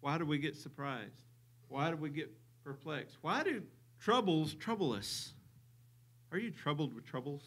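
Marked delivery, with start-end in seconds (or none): 3.2-7.4 cheesy TV pitchman asking; infomercial voice. Pretend. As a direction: sell it like a late-night infomercial. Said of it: "Sound like an infomercial".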